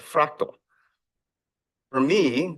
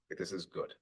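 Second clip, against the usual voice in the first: whispered; 16 dB quieter than usual. Retold or imitated. Father's tender moment retold.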